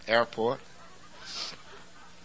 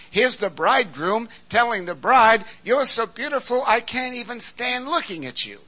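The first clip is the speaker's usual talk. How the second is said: high, loud, driving sermon delivery; full-force preaching; 10 semitones higher, 9 dB louder than usual. Acted. Preacher driving home the bridegroom's love to the church, in full force. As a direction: preach it hard to the congregation.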